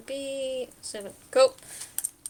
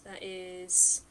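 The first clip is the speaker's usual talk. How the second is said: normal talking voice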